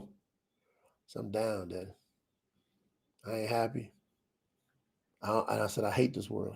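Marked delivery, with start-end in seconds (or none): 1.2-6.5 much softer, subdued confession; down and low. Retold or imitated. Son quietly telling his daddy he's not okay, subdued. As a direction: say it quietly like you're barely holding on.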